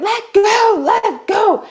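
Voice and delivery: high-pitched frantic scream; losing-it shriek. Retold or imitated. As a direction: scream for the dog to release. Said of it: "and I'm screaming at".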